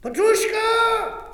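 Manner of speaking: loud, high call into the dark. Acted. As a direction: shout for the servant. Calling out for servants.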